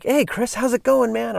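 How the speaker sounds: a hint of a cheery old skater calling out; Larry's greeting. Old skater buddy hails Chris in the parking lot, lightly voiced and high-pitched.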